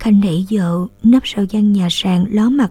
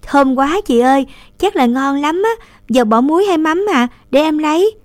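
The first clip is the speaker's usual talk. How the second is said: put-on higher, quick eager little girl; 7 semitones higher than usual. A narrator's reading. Little sister offering to help her cooking sister, eager. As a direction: jump in eagerly like a keen child. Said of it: "Nương nhanh nhẩu".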